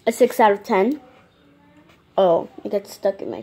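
speaking between chews; chewing candy while talking. Real, not acted. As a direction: mouth full of sticky candy. Seen 1: Candy just unwrapped; closed mouth chewing, then finger picks teeth.

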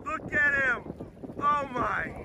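ordinary delivery

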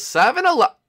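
high, loud rallying call; excited announcement. Acted. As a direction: call it out eagerly to the boys. Talking normally outside, announcing destination.

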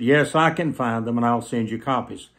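ordinary talk, nothing marked

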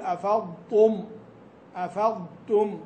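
"أفضتم" slow, ت kept light and thin after ض; don't make the ت in "أفضتم" emphatic; light ta after dad. Shown as a demonstration. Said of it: "ألا تفخم التاء". (ترقيق التاء بعد الضاد)

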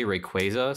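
"Rayquaza" with a long A, "ray-KWAY-zuh"; "Rayquaza" with KWAY in the middle; others' pronunciation. Shown as a demonstration. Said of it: "I've heard more people say Rayquaza".

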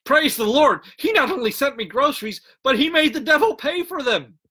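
high-pitched gleeful praise; exultant shout. A narrator's reading. Woman claps hands at neighbor's admission, exultant high shout. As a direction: cry out in triumph, pitch climbing to the punchline. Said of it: "and shouted".

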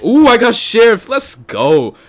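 sudden loud, high whoop; excited cheer. Real, not acted. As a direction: burst out cheering at a lucky draw. Gets the wanted role.

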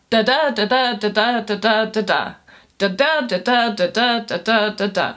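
da da in steady da-DUM beats; sing-song stressed and unstressed da da; iambic rhythm. Shown as a demonstration. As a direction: chant the da-DUM pulse of iambs. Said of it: "Iambic pentameter is that".

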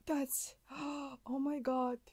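breathless gasps, then a hushed oh my God; gasping shock. Real, not acted. Streamer reading a shocking file, gasping hushed. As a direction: suck in air, stunned, barely voiced.

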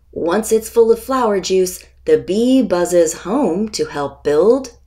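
ordinary delivery, nothing marked

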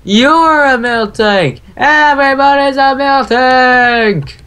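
high, slow talk-show host call; Oprah-style announcer. Pretend. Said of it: "what is this, the Oprah show?".

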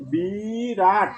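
বিরাট stretched slowly as "bii-raat"; drawn-out pronunciation. Shown as a demonstration. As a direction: draw out both syllables of বিরাট. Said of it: "এখানে আমাদের উচ্চারণ হবে". (বিরাট টেনে টেনে উচ্চারণ)

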